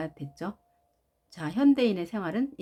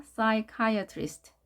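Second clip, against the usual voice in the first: very slow psychiatrist, stress on KI, falling; stress demo; 3 semitones higher, much slower than usual. Shown as a demonstration. Teacher showing stress: very slow demo. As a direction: stretch each syllable. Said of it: "강세를 보겠습니다".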